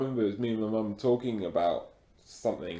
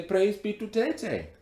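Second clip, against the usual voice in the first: mock worship; 12 semitones higher than usual. Dare: worship ritual in accent.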